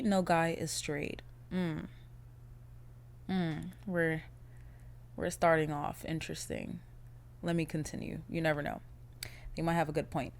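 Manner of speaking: ordinary talk, nothing marked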